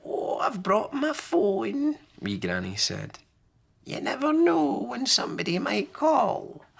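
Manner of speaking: put-on a cheerful little old lady; high sing-song granny; wee Granny.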